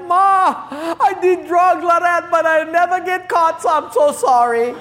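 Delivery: very high, loud wail to Ma; hammed-up apology from a sorry boy; mock confessing son. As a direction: pretend to blubber a confession to mother. Imagined son confessing to his mother.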